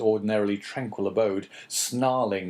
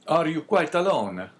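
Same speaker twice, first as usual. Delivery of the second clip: put-on Italian accent; 6 semitones higher, 4 dB louder than usual. A narrator's reading. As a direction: weigh the name, Italian accent. Priest voice in story.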